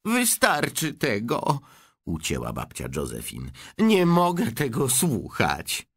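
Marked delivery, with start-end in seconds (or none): 0.1-1.6 put-on slow, higher old-woman voice cutting him off; an old lady who has heard enough; grandma snapping slowly for silence; stern old grandma. 3.8-5.9 put-on slow, higher old-woman voice cutting him off; an old lady who has heard enough; grandma snapping slowly for silence; stern old grandma.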